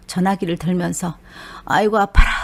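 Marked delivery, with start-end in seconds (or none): 1.6-2.3 loud groaning cry of pain; pained outcry. Retold or imitated. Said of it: "먼저 입에서 이렇게 나와서". (아파서 터져 나온 큰 외침)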